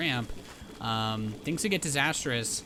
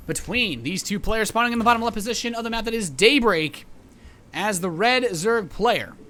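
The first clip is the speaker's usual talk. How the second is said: loud, high arena announcer voice; hype announcer; 5 semitones higher, 10 dB louder than usual. Acted. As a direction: pump up the crowd like a ring announcer. Introducing the two players.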